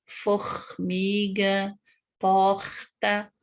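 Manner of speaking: Rio de Janeiro throaty R in "Formiga, porta"; slow Rio-accented demo. Shown as a demonstration. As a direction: say the R breathy at the back of the throat, Rio style. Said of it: "no Rio de Janeiro".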